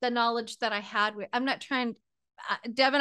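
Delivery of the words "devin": ordinary talk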